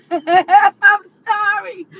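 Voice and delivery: giggling through it slowly; can't stop laughing; laughing apology. Real, not acted. Reacting to the ranting video, laughing helplessly.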